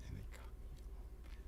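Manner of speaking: hushed whisper; faint whispered memory. Retold or imitated. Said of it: "When the body tells you".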